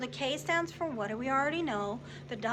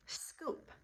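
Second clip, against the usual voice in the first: whisper; 10 dB quieter than usual. Shown as a demonstration. Teacher whispers the new word.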